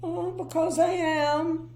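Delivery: high, sniffy and glum; sniffy miserable voice. Acted. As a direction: sniff it out disdainfully. Mister Miserable replies.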